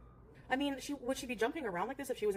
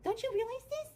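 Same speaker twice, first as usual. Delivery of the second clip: much higher, exasperated talk to the cat; scolding pet talk; 11 semitones higher than usual. Real, not acted. Scolding the cat after surgery.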